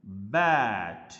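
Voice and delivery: बैट slow, with the vowel opened wide. Shown as a demonstration. Teacher to students, opening the vowel of बैट wide. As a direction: spread the lips and stretch बैट slowly. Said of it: "lips ऐसे wide करके".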